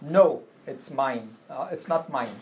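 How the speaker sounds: slow, deliberate no it's mine; slow model answer. Shown as a demonstration. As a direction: spell out the answer for students. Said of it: "you to say".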